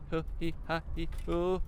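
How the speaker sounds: tense, playful driver's noises; nervous puffing syllables. Real, not acted. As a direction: puff out singsong syllables through a tricky lane change. Nervous noises while changing lanes.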